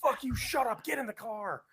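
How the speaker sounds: high-pitched angry snapping order; retold angry outburst. Wife orders cheating husband into the car, retold angry outburst. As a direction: imitate a wife ordering someone off in anger. Retold wife's furious quarrel.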